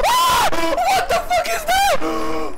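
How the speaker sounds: very high, loud shriek; terrified scream. Real, not acted. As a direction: scream the question in sudden terror. Jump scare in horror game.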